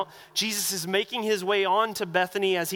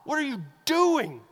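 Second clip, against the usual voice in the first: sharp, higher scolding hush; hushing rebuke; 6 semitones higher, slower than usual. Retold or imitated. Crowd hushing the blind men.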